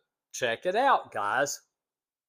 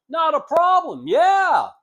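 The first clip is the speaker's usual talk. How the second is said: much higher and much louder, a happy eager agreement; eager yes; 14 semitones higher, 10 dB louder than usual. Retold or imitated. Business owner accepting the lunch invite, loud and eager. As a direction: toss it out high and loud, glad to be asked.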